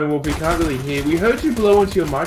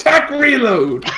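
excited shout; 9 semitones higher, slower than usual. Acted. Joking callout in game.

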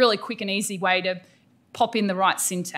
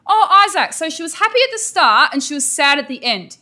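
bright, much higher and louder teacher voice repeating an answer; sing-song overpraising teacher voice; mock teacher repeat; 6 semitones higher, 6 dB louder than usual. Shown as a demonstration. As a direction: gush it back like an eager teacher.

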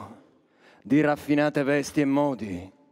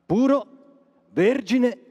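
exalted rise; 8 semitones higher, 4 dB louder, slower than usual. Acted. Exalting pure boys' voices.

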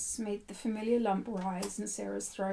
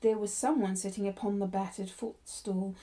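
ordinary delivery

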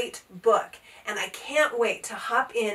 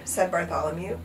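plain reading voice

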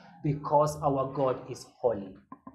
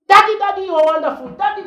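put-on very high, loud child crying daddy; gushing son; 9 dB louder, much faster than usual. Esau fawning over his father, high and loud. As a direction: squeal for daddy like a fawning boy. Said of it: "can you imagine Esau coming to me".

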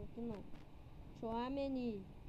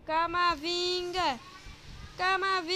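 excited commentary; 3 semitones higher, 8 dB louder than usual. Real, not acted. Live commentary, player on the ball.

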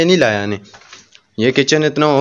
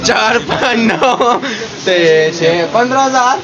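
mock counting; 8 semitones higher than usual. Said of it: "ऐसे ये भाई कहते हैं".

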